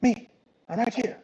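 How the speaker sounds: lightly voiced, impatient shield companion; a hint of a cheeky small creature; talking shield. Game master plays the talking shield.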